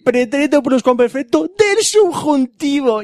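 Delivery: high, drawn-out mock shout. Shown as a demonstration. Friend demonstrating a tense that kills Englishmen, mock shouting. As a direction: proclaim the grammar term slowly, high-pitched. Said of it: "si se lo dices muy alto".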